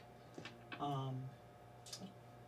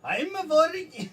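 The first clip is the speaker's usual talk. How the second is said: put-on Scottish accent; 9 semitones higher, 8 dB louder than usual. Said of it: "I can't do my Scottish accent at all!".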